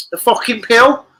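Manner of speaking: high, loud incredulous question; can't believe that pick; shocked disbelief. Real, not acted. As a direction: yelp it in disbelief.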